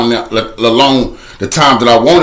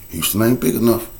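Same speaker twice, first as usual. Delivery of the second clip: much softer, lower ominous refrain; hushed refrain; 4 semitones lower, 8 dB quieter than usual. Acted. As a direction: sink the line to a low hush. Repeated refrain.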